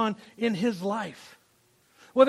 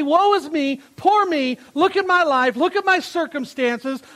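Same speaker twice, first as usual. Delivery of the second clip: whiny self-pity, pitched up and louder; playing the victim; 5 semitones higher, 5 dB louder than usual. Preacher mocking a self-pitying Paul, whiny and raised. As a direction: moan it like a martyr feeling sorry for himself. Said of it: "He didn't play victim".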